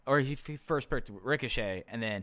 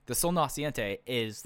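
ordinary delivery

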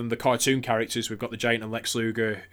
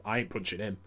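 quick, quiet, timid backpedal; hurried, hushed retreat from a fight; backing off nervously; 4 semitones lower, 9 dB quieter, faster than usual. Retold or imitated. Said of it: "Bulldog just sort of stepped off and just went".

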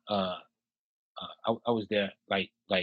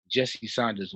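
normal talking voice

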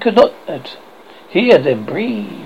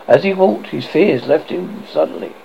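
usual speaking voice

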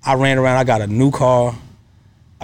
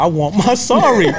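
laughing; 5 semitones higher than usual. Retold or imitated. Laughing at his own stubbornness.

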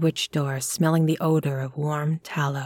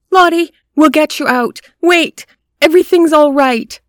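loud, much higher reassuring yell; urgent shout; 11 semitones higher, 11 dB louder than usual. A narrator's reading. Robert shouting reassurance to Lottie behind the door. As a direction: shout comfort through the panels.

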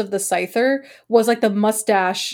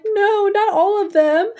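put-on childhood despair; 10 semitones higher, 3 dB louder, slower than usual. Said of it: "I'm like".

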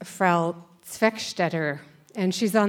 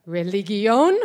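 loud rising question in German; German teacher's question; 5 dB louder, slower than usual. Retold or imitated. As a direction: imitate a German teacher asking, voice climbing at the end. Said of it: "in this louder voice".

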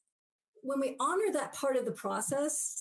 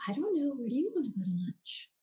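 meek people-pleaser; 3 semitones higher than usual. Retold or imitated. Meek deferring lunch voice acted.

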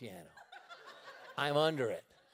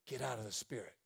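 low soft urging; a hint of a low whisper; low quiet voice; 6 semitones lower, 9 dB quieter than usual. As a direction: say it low into his ear. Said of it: "and he said".

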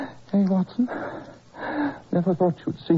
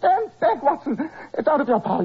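high, loud cry to keep him away; frantic warning; 9 semitones higher, 3 dB louder than usual. Acted. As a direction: shout him back, desperate.

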